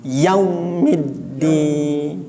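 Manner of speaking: slow chanted Maliki yaumiddin; slow tajwid model reading; model recitation. Shown as a demonstration. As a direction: recite it slowly for students to repeat. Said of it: "ini diulang-ulang".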